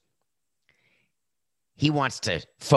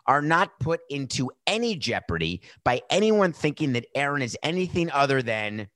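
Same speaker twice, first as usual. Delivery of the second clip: ordinary delivery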